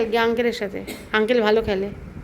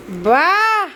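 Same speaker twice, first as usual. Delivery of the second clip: delighted praise; 6 semitones higher, 6 dB louder, much slower than usual. Real, not acted. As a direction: cheer her on like a proud aunt. Praising a little girl's writing. (উঁচু, জোরালো গলায় উচ্ছ্বসিত প্রশংসা)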